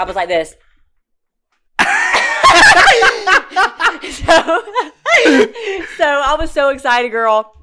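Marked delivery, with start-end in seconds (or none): 1.8-4.1 high, long shrieking laugh; loud laugh. Real, not acted. Laughing at her own pose. 4.1-7.5 high and loud through laughter; laughing. Real, not acted. Still laughing about the picture.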